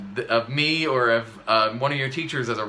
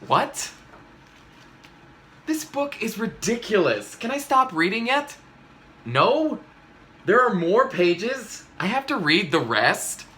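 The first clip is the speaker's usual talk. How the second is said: exasperated whining; 7 semitones higher than usual. Acted. Complaining about the book.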